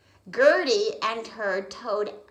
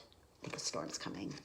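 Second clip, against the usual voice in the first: whisper; 16 dB quieter, faster than usual. Real, not acted. Aside to child listener.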